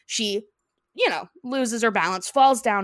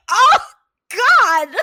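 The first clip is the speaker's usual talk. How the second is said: mock horrified yell; 12 semitones higher, 10 dB louder than usual. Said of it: "I was like".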